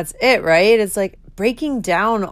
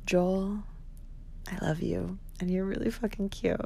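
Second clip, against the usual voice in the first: very soft, hushed admission; tender confession; 11 dB quieter than usual. Real, not acted. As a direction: confess it softly, with pauses. Confesses to her crush.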